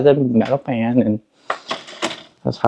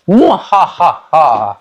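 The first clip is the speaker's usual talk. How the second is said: mad scientist laugh; 3 semitones higher, much slower than usual. Acted. Boasting about a spare transmitter.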